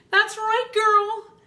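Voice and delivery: very high, loud sassy brag; sassy boast. Retold or imitated. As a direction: toss it off like a proud diva. She boasts to friend.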